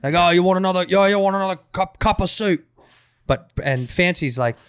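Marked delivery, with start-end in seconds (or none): 0.1-2.6 light Cockney waiter impression; mock Cockney, a touch higher and louder; lightly done Cockney accent. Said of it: "it's like Cockney".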